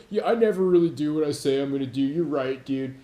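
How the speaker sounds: fast, meek-agreeing mockery; hammed-up pushover caving in. Mocking a pushover admitting he never follows through.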